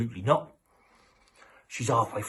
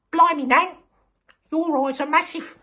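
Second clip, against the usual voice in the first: put-on much higher and louder astonished kid voice; shocked little girl; 6 dB louder than usual. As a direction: gasp it out like a startled little girl. Red shocked at wolf-nan in bed.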